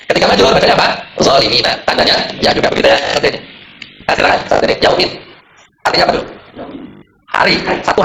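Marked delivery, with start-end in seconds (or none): none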